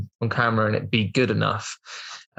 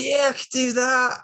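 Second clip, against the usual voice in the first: very high, drawn-out fake assurance; mock-eager bluff; 13 semitones higher than usual. Actor bluffing to the director about skills, mock-eager. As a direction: squeak out an overconfident yes, slowly.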